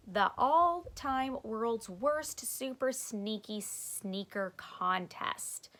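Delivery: slow, drawn-out reading aloud. Acted. Stink reading the flyer aloud, slow. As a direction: sound out each word carefully.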